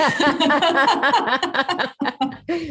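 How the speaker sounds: embarrassed burst of laughter; laughing. Real, not acted. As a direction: laugh it off, a bit flustered. Embarrassed at her own stutter.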